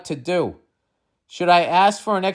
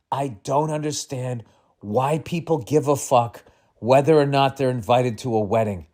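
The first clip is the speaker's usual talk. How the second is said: ordinary delivery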